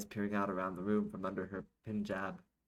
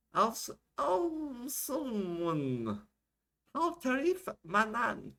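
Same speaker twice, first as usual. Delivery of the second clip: high, slow, drawn-out rant; an older woman holding forth, pitched way up; 4 dB louder, much slower than usual. Acted. Manjeet addresses the camera, high impassioned rant. Acting ranting Arabic-speaking woman.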